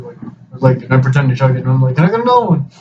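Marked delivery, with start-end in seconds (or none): 2.0-2.7 mock innocent request. Pretends to chug, asks more.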